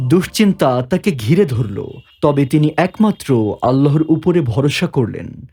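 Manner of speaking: ordinary delivery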